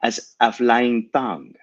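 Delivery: put-on slow, thick French accent; small French girl. A narrator's reading. Unseen girl replies to the vampires, thick French accent. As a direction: stretch it out in a heavy French accent. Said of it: "small girl's voice in a thick French accent".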